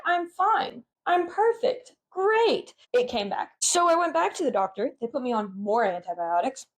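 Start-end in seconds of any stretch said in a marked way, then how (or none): 0.1-2.7 high-pitched mock-confident cheer; retold cheeriness. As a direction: chirp it, pitch climbing word by word. Retells own false cheer on antibiotics.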